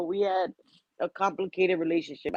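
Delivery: usual speaking voice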